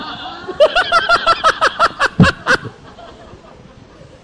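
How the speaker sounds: loud high laugh. Real, not acted. (tertawa keras dan tinggi)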